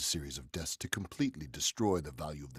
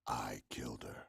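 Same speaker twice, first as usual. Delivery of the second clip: softer, whispered threat; menacing whisper; 6 semitones lower, 6 dB quieter than usual. Acted. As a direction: confess in a chilling whisper. Killer's confession.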